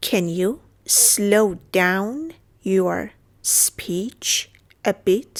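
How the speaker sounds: very slow, with pauses between chunks; halting, careful dictation pace; slow broken-up repeat. Shown as a demonstration. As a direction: break it up piece by piece for learners. Teacher repeats phrase in pieces.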